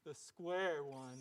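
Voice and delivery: slow, quiet and drowsy; slow sloth voice. Acted.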